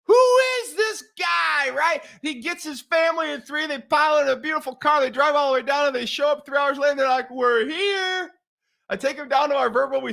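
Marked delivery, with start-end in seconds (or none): 0.0-1.7 high, drawn-out cry of disbelief; amazed shout. Retold or imitated. Todd marvelling at the coach's spontaneity, amazed shout. 7.3-8.3 high, singsong announcement; arrival call. Retold or imitated. Family turning up three hours later, singsong call.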